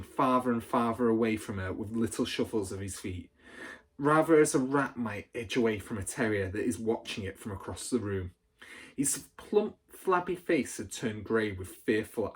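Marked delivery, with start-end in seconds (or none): none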